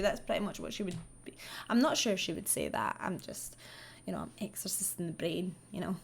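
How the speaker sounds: soft unvoiced whisper, quick; whispered aside. Real, not acted. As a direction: confide it under your breath. Whispered aside after the impression.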